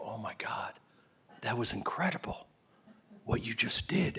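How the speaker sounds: hushed, breathless praise as the mother; awed whisper. Retold or imitated. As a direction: whisper it like you are stunned by the scene. Mother praising after a performance.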